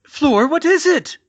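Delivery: high, loud gasped question; alarmed gasp. Acted. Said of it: "Mum gasped".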